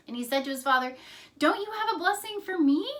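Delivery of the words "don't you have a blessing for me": high, loud, urgent acted question; quick pleading, pitched well up; heartbroken plea. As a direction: imitate a wronged son begging his father. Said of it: "He was heartbroken".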